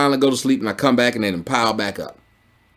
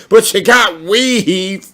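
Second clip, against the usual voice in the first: mock gossip; 7 semitones higher, much slower than usual. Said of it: "you didn't hear me say".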